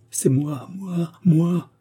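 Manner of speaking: anguished, high-pitched outburst; horrified realisation. A narrator's reading. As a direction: voice climbing high on each repeat. Doomed man's horrified self-recognition.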